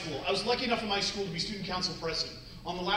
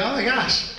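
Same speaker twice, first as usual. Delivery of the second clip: put-on gushing girl; 4 semitones higher, 5 dB louder than usual. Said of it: "I don't know why you touch your nose".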